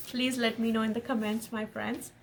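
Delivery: ordinary talk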